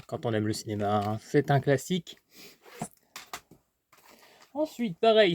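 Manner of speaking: ordinary delivery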